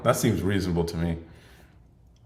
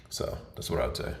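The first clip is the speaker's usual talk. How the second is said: deep morning voice demo; 7 semitones lower, 9 dB quieter than usual. Shown as a demonstration. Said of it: "really deep 'cause I'm tired".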